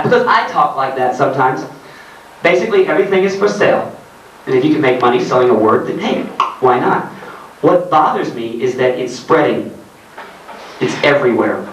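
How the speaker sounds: normal talking voice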